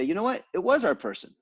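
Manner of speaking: a touch of a sheepish contractor owning up; mildly voiced confession; admitting fault. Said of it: "and they say".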